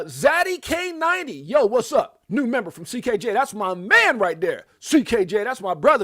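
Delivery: loud, high hype greeting; big up a friend across the room; booming, excited welcome; hyped shout-out. Real, not acted. Shouting out a new member.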